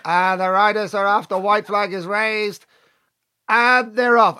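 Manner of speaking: high-pitched mock racecourse announcer; drawn-out call, rising in pitch and level; race commentary. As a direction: imitate a commentator calling the start.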